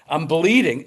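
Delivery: ordinary talk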